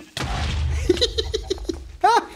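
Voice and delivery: high, breathy giggle; long high cackling giggle; giggling laugh. Real, not acted. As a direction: giggle away in a high pitch. Laughs at game jump.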